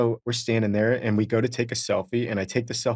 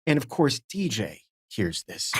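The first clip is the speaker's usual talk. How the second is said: ordinary talk, nothing marked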